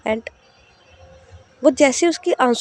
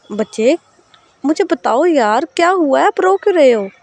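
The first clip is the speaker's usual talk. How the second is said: higher, louder coaxing voice, worried; caring older sister; 4 semitones higher, 4 dB louder than usual. Acted. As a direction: gently press a crying girl to talk. Sahar asks why she cries.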